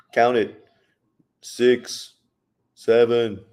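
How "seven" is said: ordinary talk, nothing marked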